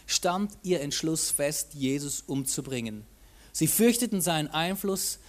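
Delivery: measured Bible reading to the congregation; scripture reading. A narrator's reading. Preacher reading the temple story to the church, measured. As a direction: read the gospel passage out, steady and clear.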